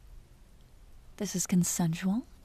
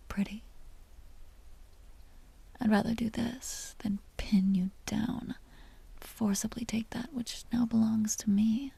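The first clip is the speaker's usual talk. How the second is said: a vampire's hushed, teasing whisper close to the ear; seductive whisper; 4 dB quieter than usual. Acted. Vampire whispering to the hunter she wants to bite, seductive whisper. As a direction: lure him in with soft breathy secrets.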